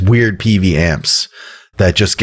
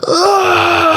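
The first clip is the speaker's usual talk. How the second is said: much higher drawn-out mock hardcore scream; imitating a singer's sustained scream; imitated long yell. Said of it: "he just goes like".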